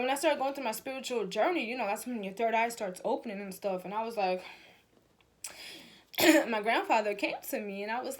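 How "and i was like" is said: normal talking voice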